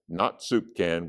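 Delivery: usual speaking voice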